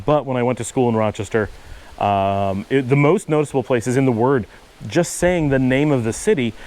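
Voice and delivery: ordinary talk, nothing marked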